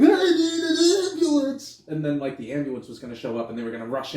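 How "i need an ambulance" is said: very high, loud, drawn-out mock shriek; panicked scream. Officer imagined calling for backup, hammed-up hysterical scream. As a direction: wail for help in over-the-top panic.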